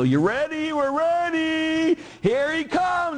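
loud, high TV evangelist hype; high, drawn-out showman's build-up; Benny Hinn. Retold or imitated. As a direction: imitate a faith healer working up the crowd. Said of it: "kinda like Benny Hinn".

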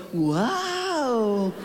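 a little dog answering; mild woof. Acted. The dogs in the mirror hall woofing back. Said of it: "Y todos los perritos".

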